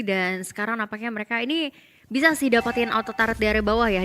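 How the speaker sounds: normal talking voice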